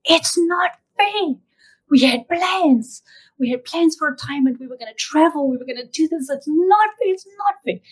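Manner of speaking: high-pitched anguished protest, acted without full volume; retold yelling. Husband in the elevator protesting his wife's illness, mock yelling. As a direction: imitate a distraught man's yell, drawing out the first words. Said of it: "he starts yelling".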